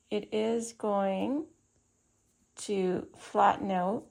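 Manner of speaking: talking around a pin in the mouth; slow words past a pin held in the lips. Real, not acted. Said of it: "I have a pin in my mouth".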